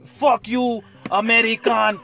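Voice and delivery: Russian local telling off an American guy, mock Russian accent. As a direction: snarl it like a hostile Russian to a tourist. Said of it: "I don't know if that's appropriate in fucking accent".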